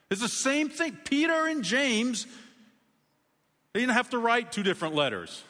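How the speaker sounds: excited. Real, not acted. Preacher stressing that both letters match, excited high insistence.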